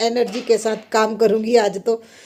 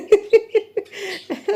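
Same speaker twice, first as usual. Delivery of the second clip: high giggle; 9 semitones higher than usual. Real, not acted. Son complaining he was hit.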